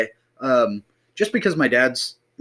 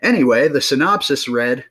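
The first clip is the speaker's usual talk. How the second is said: ordinary delivery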